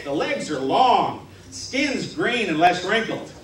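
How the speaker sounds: usual speaking voice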